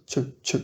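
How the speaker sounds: "tche" quietly with a soft TCH start. Shown as a demonstration. Teacher repeating "tche" quietly for the class. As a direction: breathe out a gentle "tche". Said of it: "il va presque se prononcer tche".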